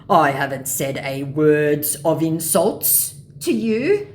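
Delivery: slow, loud scammer voice; wronged mock scammer. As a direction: protest word by word.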